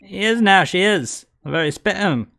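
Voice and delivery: high Cockney sidekick agreeing; Cockney crook. Acted. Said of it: "they are Cockney".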